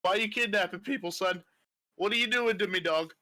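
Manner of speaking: high, fast rough accusing; half-orc voice. Acted.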